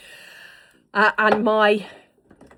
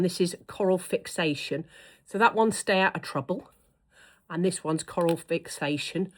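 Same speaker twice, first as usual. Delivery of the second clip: usual speaking voice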